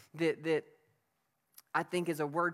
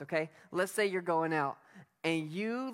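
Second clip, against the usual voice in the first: ordinary delivery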